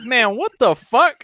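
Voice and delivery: high, slow stunned "what the fuck"; shocked buddy. Retold or imitated. Buddy sees the splatter, high and shocked. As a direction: drag it out, stunned and loud. Buddy reacting to deer story.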